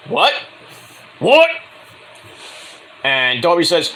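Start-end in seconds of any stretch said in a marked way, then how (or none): 0.1-1.5 second what jumps high and loud; mock disbelief. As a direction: act baffled, rising to an outburst. Champ reacting in disbelief.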